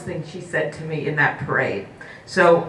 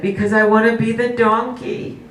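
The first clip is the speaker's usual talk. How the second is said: put-on childlike plea; 6 semitones higher than usual. Mother voices small daughter.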